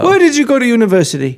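hammed-up British voice; imitating a British accent. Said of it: "You're doing British".

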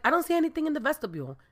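high, rushed mock bewilderment; fast, high-pitched confused protest; baffled reply. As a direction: act out puzzled denial, quick and high. Retold baffled reply to coworkers.

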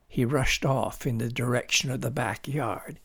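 plain reading voice

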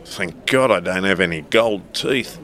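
usual speaking voice

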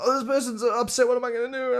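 high-pitched mock panic; mock freak-out. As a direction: play your younger self freaking out. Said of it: "I'd freak out about it".

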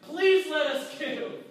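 high, loud pleading please; eager believers begging Paul to let them give; begging Macedonians. Retold or imitated. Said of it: "He says they're begging".

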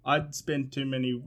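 usual speaking voice

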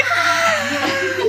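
high laughing squeal; high delighted child's squeal; kid's gleeful shriek. Retold or imitated. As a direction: shriek with glee like a small child. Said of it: "the kid was like".

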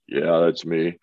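a hint of deep modest Paul; Paul's voice. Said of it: "Paul talks like this".

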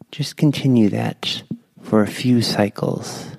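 guided breath prayer. Acted. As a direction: lead them gently through each breath.

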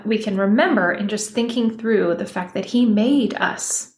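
ordinary talk

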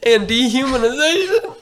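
words breaking into laughter; laughing. Real, not acted. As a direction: laugh through the word. Laughing through the definition.

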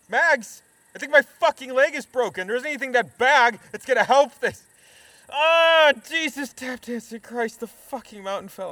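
high, loud shouting through the pain of a broken leg. Acted. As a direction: yell it out, he is hurt badly.

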